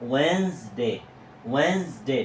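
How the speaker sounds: "ওয়েন্সডে" as WENS-day, silent d; correct Wednesday. Shown as a demonstration. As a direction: model "ওয়েন্সডে" in two syllables. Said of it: "আমি উচ্চারণটা দিয়ে রেখেছি".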